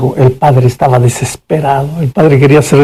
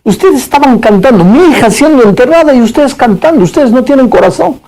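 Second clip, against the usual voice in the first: aggressive father; 8 semitones higher than usual. Retold or imitated. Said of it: "le dijo a la chica agresivamente".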